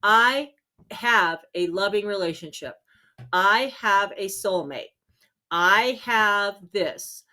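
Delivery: slow, deliberate affirmations; calm certainty, word by word; confident affirmation. Shown as a demonstration. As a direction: state each line slowly as already true. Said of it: "These are the words that you want to use".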